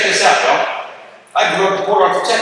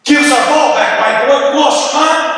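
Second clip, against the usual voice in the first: angry demand; 6 semitones higher than usual. Retold or imitated. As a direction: bark it like furious prisoners wanting their ball back. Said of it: "and they are mad".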